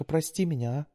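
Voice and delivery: put-on high, pleading young woman; daughter voice. A narrator's reading. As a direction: coax like a sweet daughter.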